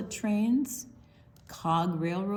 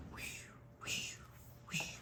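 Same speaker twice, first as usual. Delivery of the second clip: whispered whoosh; 16 dB quieter than usual. Acted. Sound of speeding car.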